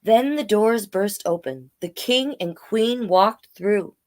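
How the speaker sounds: storybook read-aloud in rhyme; story narration. A narrator's reading. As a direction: tell it gently like a bedtime tale.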